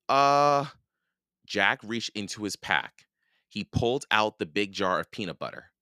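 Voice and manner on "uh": loud, drawn-out high filler; hesitating. A narrator's reading. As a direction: stall with a long, high "uh". Boy searches pack for an offering.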